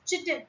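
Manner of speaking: chitin said with a CH, "CHIT-in"; mispronounced chitin. Shown as a demonstration. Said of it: "so many of us pronounce as".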